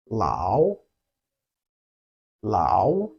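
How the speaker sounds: MA, BA, YE, LAO each low, then rising; small low dip and upswing on MA, BA, YE, LAO; third tone. Shown as a demonstration. Teacher gives examples of the falling-rising tone. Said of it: "You can only drop".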